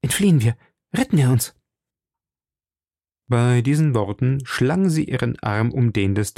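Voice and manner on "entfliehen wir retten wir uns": a touch of fright, urgent and hushed-quick; mildly alarmed young woman urging escape; frightened. A narrator's reading. Madame Bonacieux frightened, urging D'Artagnan to flee. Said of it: "entgegnete Madame Bonacieux erschreckt".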